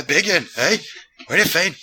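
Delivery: thick mock Glasgow accent; mimicked Glaswegian. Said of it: "he's all bleary-eyed".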